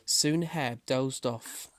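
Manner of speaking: plain reading voice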